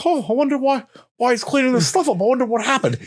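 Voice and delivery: mock innocent musing; playing dumb. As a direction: act clueless, pitch up. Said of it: "You're playing dumb the whole time".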